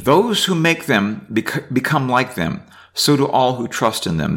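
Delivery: reading scripture aloud. Acted. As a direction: read the psalm out to the class. Reads psalm aloud.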